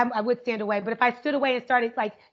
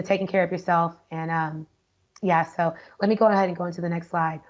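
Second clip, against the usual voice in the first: bored, low monotone; 6 semitones lower than usual. Shown as a demonstration. Teacher demos a dull delivery to online students, low and bored. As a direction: sound like you don't want to be here. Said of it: "the voice came down and I was like".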